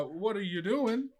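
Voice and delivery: usual speaking voice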